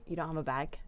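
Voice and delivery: low, hushed mimicked question; imitated customer. Retail worker imitating a customer asking for bags, quietly. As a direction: drop low and soft as the customer. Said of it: "women will be like".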